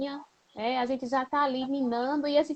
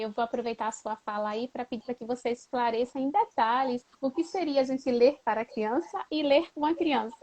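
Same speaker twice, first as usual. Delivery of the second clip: normal talking voice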